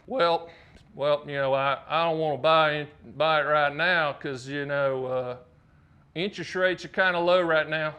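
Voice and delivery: hesitant Southern caller, stretching words. Retold or imitated. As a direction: imitate a Southern customer hedging. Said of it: "Let me do a Southern voice".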